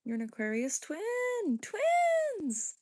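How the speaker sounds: slow, stretched high squeal on the last word; excited squeal. Real, not acted. Streamer thrilled at a viewer's shared sign, excited squeal. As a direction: squeal it out, pitch shooting up.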